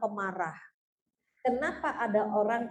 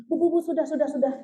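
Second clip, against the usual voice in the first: louder, hurried plea to stop; urgent nurse; 3 semitones higher, 4 dB louder, faster than usual. Retold or imitated. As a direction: cut the mother off, urgently. Nurse urgently stops breastfeeding mother.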